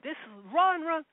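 high loud urgent shout; yelling run. Retold or imitated. Onlooker shouting at the ram to run, high and loud. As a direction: shout it, pleading.

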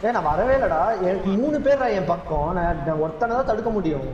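loud, fast complaining; loud protest. Real, not acted. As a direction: shout your defence to your mates. Protesting loudly to teammates.

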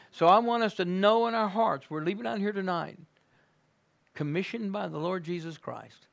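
normal talking voice

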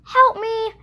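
high, loud yelp for help. Acted. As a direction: yelp it out in fright. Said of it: "yelped Fox".

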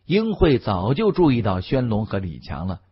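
plain reading voice

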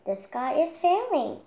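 high, loud frantic crying out; panicked cry. Acted. Chick running round the tree, crying out frantically. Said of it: "he cried".

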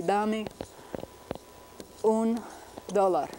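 Dame un dólar in Spanish, word by word; careful Spanish vowels in Dame un dólar; Spanish pronunciation. Shown as a demonstration. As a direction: pause between Dame, un and dólar, first syllables stressed. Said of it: "everyday orthography would be".

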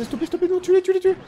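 high, urgent calls to the troops; urgent cries. Real, not acted. Player urgently urging his game units to kill. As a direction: urge the units on, high-pitched.

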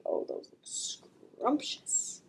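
slow hushed whisper of a hungry bird; birds' whispered thought. Acted. Birds eyeing worms, whispering hungrily. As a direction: breathe it like a secret craving. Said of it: "looking at the worms and thinking".

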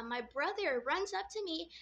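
usual speaking voice

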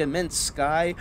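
plain reading voice

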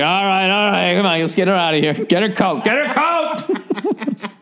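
urgent hustle-her-out voice, climbing in pitch; lightly acted man hurrying someone out, last words stretched high; exasperated party companion. Man getting drunk dancing wife out of party, mock urgency.

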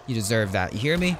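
ordinary talk

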